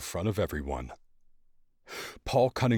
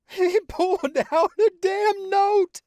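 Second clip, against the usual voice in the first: very high, loud, slow breathless cry; barely breathing from laughing; gasping with laughter; 8 dB louder, slower than usual. A narrator's reading.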